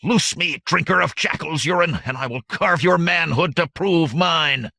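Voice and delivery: high, loud furious tirade; raging howl. Acted. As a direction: spit the threats out in fury. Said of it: "the tribesman howled".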